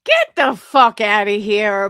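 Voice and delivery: bursts out loud and scornful; loud outburst. Real, not acted. Streamer scoffing at a rival's grief, loud outburst. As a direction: get the fuck out, shouted in disbelief. Outraged at Brittany's grief claim.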